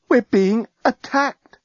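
high, slow, stunned alarm; alarmed realisation. Acted. Woman seeing spacecraft hurtle towards the ship, alarmed. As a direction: draw it out in dawning horror. Lucy realises they are under attack.

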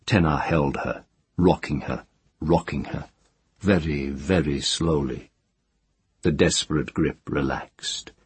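plain reading voice

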